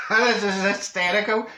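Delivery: amused, laughing as they speak. Real, not acted. Comic tickled by their own story about the note, laughing. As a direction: laugh into the words.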